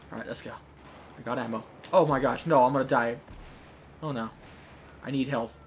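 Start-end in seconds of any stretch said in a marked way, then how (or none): none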